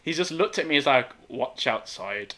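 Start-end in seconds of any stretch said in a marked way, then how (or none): 1.3-2.3 slow, low and quiet, dead calm; unbothered calm warning. Retold or imitated. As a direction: say it coolly, no heat at all. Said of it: "Just said it calm".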